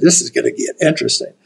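quick whispered thought to himself; whispered aside. Retold or imitated. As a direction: breathe it out fast under your breath. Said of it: "And I went".

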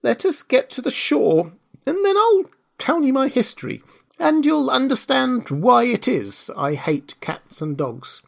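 high, grave mouse voice; trembling mouse. A narrator's reading. The mouse promises Alice its history, earnest and high. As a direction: speak solemnly as a small, high-pitched mouse. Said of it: "in a low, trembling voice".

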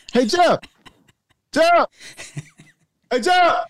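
high, calling across a parking lot; retold hailing of a friend rolling past in his car, high and calling. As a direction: hail a driver who won't stop. Retells calling to passing car.

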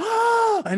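sharp high mock gasp of shock; imitated gasp. Couple caught in bed, imitated shocked gasp. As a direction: gasp like you've been caught. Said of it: "like, surprised".

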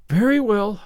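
put-on resigned girl. A narrator's reading. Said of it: "said Anne resignedly".

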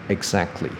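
"exactly" with the t dropped, exac-kly; teacher's own pronunciation. Shown as a demonstration. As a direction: run k straight into ly in "exactly". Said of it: "我的念法是". ("exactly"不发t，念成exac-cly)

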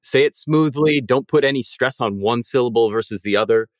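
ordinary talk

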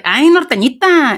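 high, drawn-out northern Mexican lilt; imitated teasing northern Mexican accent; teasing norteño accent. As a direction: coo it in a mock norteña singsong. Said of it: "They were like".

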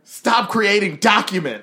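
hammed-up aggressive command; Gary Vaynerchuk. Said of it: "he's so aggressive".

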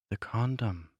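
ordinary talk, nothing marked

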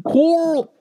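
high drawled "Coral!" yell; mock Coral yell. Host repeating the running joke: high mock yell. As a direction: shriek the name like the TV dad.

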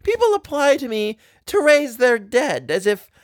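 high madman's voice with wild swoops; crazed maniac. A narrator's reading. Maniac answers the wife's entreaty, crazed. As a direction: muse crazily, stretching and swooping the words. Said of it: "whereupon he observed".

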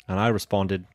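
put-on Australian-style accent, measured and deliberate; mock meditation-app host with an Australian accent; Andy from Headspace. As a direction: tell the joke in a put-on Australian accent, with long pauses. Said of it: "your Andy voice from Headspace".

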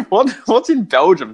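high, puzzled mock disbelief; a rising, incredulous what's-in-Belgium; baffled question. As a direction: imitate people who can't see the point. People asking why Belgium.

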